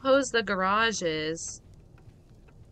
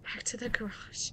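whisper; 13 dB quieter than usual. Real, not acted. Sneaking back quietly.

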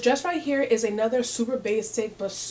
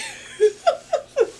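high shrieking giggle burst; high squealing laugh; 6 dB louder than usual. Real, not acted. Laugh after joke.

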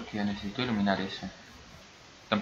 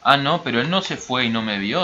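ordinary delivery

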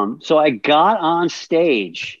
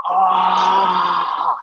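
pained cry; 2 semitones higher than usual. Retold or imitated. Groaning after kick in kidney.

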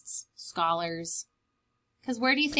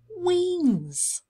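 amazed; 9 semitones higher than usual. Acted. Repeated in amazement.

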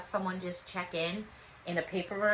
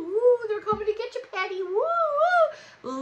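high playful woo-woo; hammed-up spooky woo; spooky ghost voice; 13 semitones higher, 7 dB louder than usual. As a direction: tease like a cartoon ghost coming to get you. Teasing Patty with spooky Nomis.

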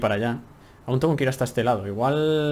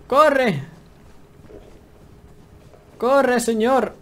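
much higher, loud urgent yell; shout; 11 semitones higher, 3 dB louder, much slower than usual. Real, not acted. Player yells at his character to run, loud and high. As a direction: scream at him to run. Fleeing while freezing to death.

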